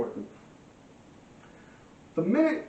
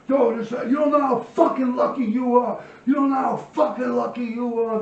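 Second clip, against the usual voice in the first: higher, louder imitated shout; mock yell; 7 semitones higher, 5 dB louder than usual. Big guy yelling at the thief on the ground, mock yell. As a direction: shout it down at him.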